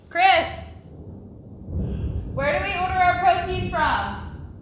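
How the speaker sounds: high and loud, calling across the house. Real, not acted. Host calling loudly to Chris about the protein brand. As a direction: shout the question to Chris in the next room.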